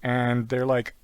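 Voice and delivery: usual speaking voice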